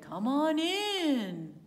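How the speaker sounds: slow, loud cow voice; talking cow. Acted. Storyteller voicing the cow inviting Mary and Joseph in, slow and loud. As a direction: draw it out big and loud like a friendly cow. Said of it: "And the cow said".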